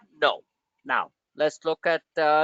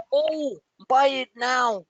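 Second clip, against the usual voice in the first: high, drawn-out mock urging; mock institutional manager; 9 semitones higher, slower than usual. Analyst mimicking late institutional managers, mock-eager. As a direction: slowly hype it up like a manager. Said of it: "every other frigging institutional manager".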